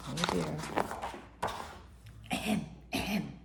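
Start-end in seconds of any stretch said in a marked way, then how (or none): 2.3-3.3 throat clearing. A narrator's reading. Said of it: "cleared her throat".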